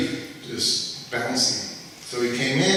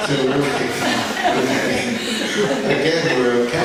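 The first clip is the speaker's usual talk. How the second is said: louder words breaking through laughter; laughing with relief; 3 semitones higher, 5 dB louder than usual. Real, not acted. Relief after the laughing punchline.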